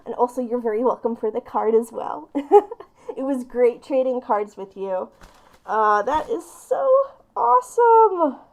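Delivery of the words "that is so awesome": touched gush. Real, not acted. Moved by the gifts.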